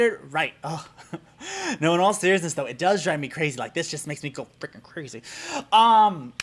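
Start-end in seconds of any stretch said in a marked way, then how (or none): none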